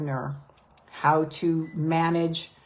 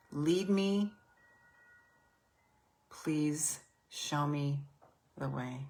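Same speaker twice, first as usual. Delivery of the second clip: slow prayer read; slower than usual. Acted. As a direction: read the prayer slowly, line by line. Reads prayer slowly for repeating.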